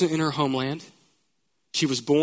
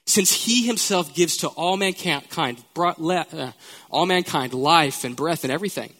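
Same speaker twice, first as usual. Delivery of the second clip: scripture reading; 4 semitones higher than usual. Acted. Reads scripture with rising force.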